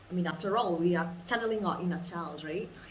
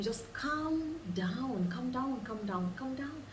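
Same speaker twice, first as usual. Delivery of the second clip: calming inner voice; 4 semitones higher, 3 dB quieter than usual. Acted. An inner voice calming someone freaking out, soothing. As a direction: gently talk someone down from panic, lightly higher and hushed. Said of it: "you hear something just telling you".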